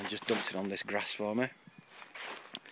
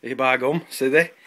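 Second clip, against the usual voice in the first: put-on Yorkshire accent; 2 semitones higher, 10 dB louder than usual. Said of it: "our allotment guru up in Yorkshire".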